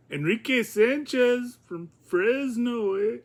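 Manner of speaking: high, slow, hammed-up Spanish accent. Shown as a demonstration. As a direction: have a shaky go at a Spanish accent. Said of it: "I can't do my Spanish accent on, on demand".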